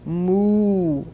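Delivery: long, drawn-out moos; imitated cattle lowing. A narrator's reading. Cattle stuck in the road, long lowing moos. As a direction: stretch each moo out like a cow. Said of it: "went the cattle".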